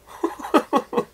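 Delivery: squeaky burst of laughter; high startled laugh. Real, not acted. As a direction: yelp of laughter as it pops. Drink bottle pops open.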